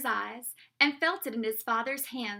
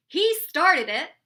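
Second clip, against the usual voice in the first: loud, raised accusation; storybook king shouting the blame; crying out; 4 semitones higher, 11 dB louder than usual. A narrator's reading. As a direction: cry out like an indignant king. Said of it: "he cried".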